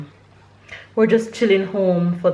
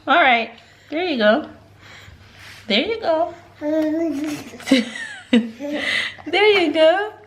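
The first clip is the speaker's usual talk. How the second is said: high, lilting encouragement; 8 semitones higher than usual. Real, not acted. Mother helping her child go down, high encouraging baby talk.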